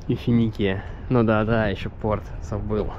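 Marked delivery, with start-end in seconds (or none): none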